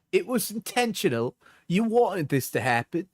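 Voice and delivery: mocking high girly voice. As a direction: read her text in a whiny mimic. Reads her messages in mock voice.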